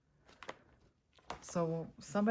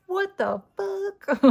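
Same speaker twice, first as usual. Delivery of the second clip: put-on much higher, louder, slow shrieking teen; imitating teen squeal; 13 semitones higher, 5 dB louder, much slower than usual. Mother imitating her teenager's shriek from the background, squealing loud and high. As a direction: squeal it like a shocked teenager in the next room. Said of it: "my teenager yelling, screaming, squealing".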